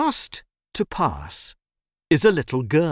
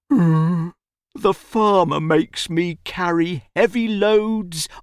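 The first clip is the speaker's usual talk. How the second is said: slow, higher-pitched, weary donkey; tired donkey; 6 semitones higher than usual. A narrator's reading. Donkey complains of the farmer to the boy, weary. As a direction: drag out the complaint like a worn-out donkey. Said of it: "looks at the boy tiredly".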